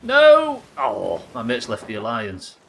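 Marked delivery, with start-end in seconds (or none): 0.0-0.6 sudden shouted no. Real, not acted. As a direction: yell it out in dismay. Sudden loss in game.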